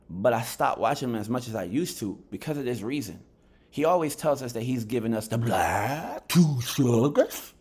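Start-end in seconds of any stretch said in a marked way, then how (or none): 5.4-7.5 slow, louder pundit catchphrase voice; imitating Showbiz. Mocking the boxing YouTuber's catchphrase for viewers, slow. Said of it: "why I'm talking like this".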